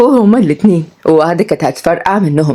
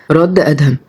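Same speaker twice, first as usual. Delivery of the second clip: ordinary delivery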